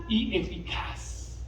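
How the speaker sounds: breathed almost unvoiced, slow; hushed. Preacher to congregation, softly breathing the words. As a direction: let the words sink to a hush. Preacher drops the phrase low.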